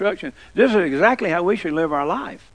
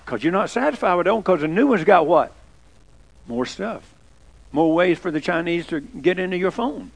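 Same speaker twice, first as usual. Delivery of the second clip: ordinary delivery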